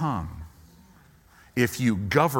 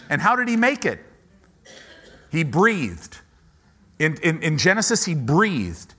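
higher, louder preaching build; full-force preaching; 6 semitones higher, 4 dB louder than usual. Acted. Pastor preaching to the congregation about God's creative breath, at full force. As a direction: drive the point home from the pulpit.

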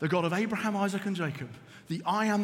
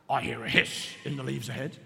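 deep, softer storybook monster; low Gruffalo voice; 9 semitones lower, 5 dB quieter than usual. A narrator's reading. Gruffalo to mouse, hearing a hiss, low voice. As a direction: drop low and soft, like a wary monster. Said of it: "till the Gruffalo said".

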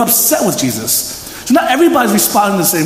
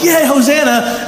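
crowd cheer; 7 semitones higher, slower than usual. Retold or imitated.

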